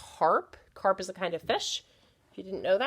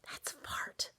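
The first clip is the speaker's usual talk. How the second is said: whispered secret; 12 dB quieter than usual. Pretend. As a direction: whisper fart like a naughty secret. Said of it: "I can do this when you're not in class".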